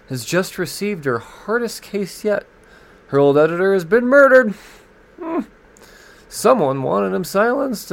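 mock movie-trailer narration; dramatic blurb read. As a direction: sell the thriller like a trailer voice. Reads solicit blurb, raised.

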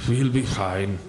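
low muttered redo; low muttered correction of the line. Shown as a demonstration. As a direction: mutter it low under the breath. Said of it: "Actually, that should have been like".

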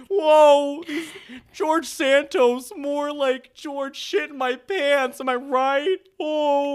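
high, loud hammed-up diva voice. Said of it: "sounds just like [name]".

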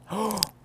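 sudden shocked intake of breath; real startled gasp; sharp gasp. Real, not acted. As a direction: gasp in alarm. Realizes she read address aloud.

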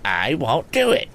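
cheeky high retort; impudent. Acted. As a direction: answer back impudently. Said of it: "retorted the red-haired lad impudently".

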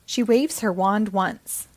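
ordinary delivery

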